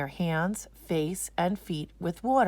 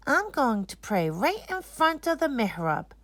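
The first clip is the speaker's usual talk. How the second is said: a hint of a proud little boy; lightly voiced eager boy; 8 semitones higher than usual. Hassan telling Daddy his plan, lightly voiced boy. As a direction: announce it like a keen kid.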